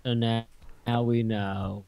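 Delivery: very soft, slow and low; subdued drawl. Pretend. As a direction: let it drawl out quietly, drained of all excitement. Deadpan after remark on drawl.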